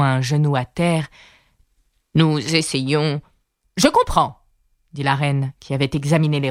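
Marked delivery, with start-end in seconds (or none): 2.2-3.2 slow, meek explanation; very humble. A narrator's reading. Kneeling gardener tries to explain to the queen: very humble. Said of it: "d'une voix très humble". 3.8-4.4 high-pitched haughty queen; imperious interruption. A narrator's reading. Queen cuts off the gardener: imperious interruption.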